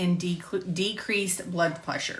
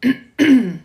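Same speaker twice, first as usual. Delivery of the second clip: loud, sudden throat clear; 6 semitones higher, 11 dB louder than usual. Real, not acted. Narrator clearing a troubled throat mid-recording, loud throat clear. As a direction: clear a throat that went weird. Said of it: "my throat got a little weird".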